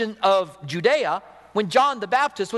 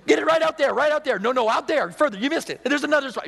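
high, excited giddy voice with a laugh; giddy fisherman; 8 semitones higher than usual. Retold or imitated. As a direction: gush like a thrilled angler landing fish after fish. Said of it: "You're like".

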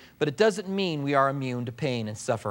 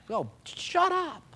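higher, slow, fed-up dismissal; exasperated retort; 11 semitones higher, 5 dB quieter, slower than usual. Real, not acted. Preacher dismisses the tactless comforter, slow and exasperated. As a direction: brush the idea off in disgust.

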